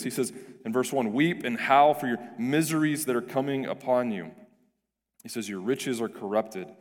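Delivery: ordinary delivery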